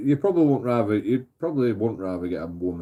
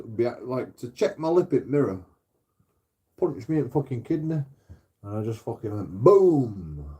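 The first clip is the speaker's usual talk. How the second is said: normal talking voice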